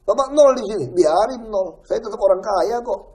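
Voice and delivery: retold defiance. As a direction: throw it back at them in anger. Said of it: "saya bilang". (membentak dengan nada tinggi)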